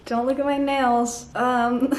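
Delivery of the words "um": ordinary delivery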